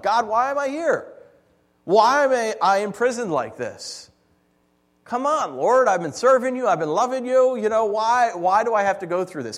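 acted-out whining protest; mock complaining prayer. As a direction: plead with the Lord like a put-upon complainer. Acts out complaining prayer to God.

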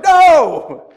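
high, full-volume comic no; mock-outraged shout; retold shouted refusal. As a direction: refuse with a hammed-up yell. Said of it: "sorry for yelling at me".

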